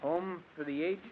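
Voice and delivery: hushed, halting question; stunned echo. Acted. Husband hears of the will from the coroner, stunned. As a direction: repeat it softly in disbelief. Learns the will excludes him.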